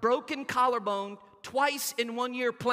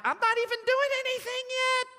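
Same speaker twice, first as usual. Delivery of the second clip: much higher, exasperated nurse voice; 14 semitones higher than usual. Retold or imitated. Nurse telling screaming boy, exasperated and high. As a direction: protest like a fed-up nurse. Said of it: "And they're like".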